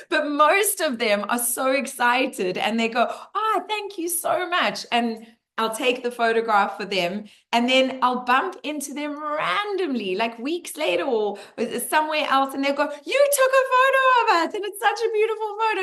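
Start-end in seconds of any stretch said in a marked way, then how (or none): 3.1-4.9 high, slow delighted thanks; delighted tourist gushing thanks, pitched way up; grateful tourist. Retold or imitated. 13.0-15.8 high, fast excited gushing; excited tourist. Retold or imitated.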